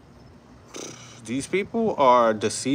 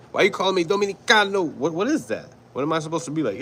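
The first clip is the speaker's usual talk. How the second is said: higher-pitched, incredulous challenge; indignant protest; 6 semitones higher, faster than usual. Retold or imitated. New Yorker answering the man who called him Dominicano, indignant. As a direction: act out an offended comeback. Acting out indignant reply to mocker.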